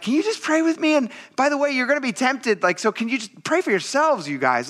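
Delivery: high-pitched exasperated plea; mock pleading. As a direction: plead way up high, as to sleepy friends. Voicing Jesus pleading with sleepy disciples.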